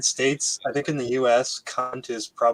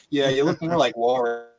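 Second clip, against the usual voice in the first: ordinary talk, nothing marked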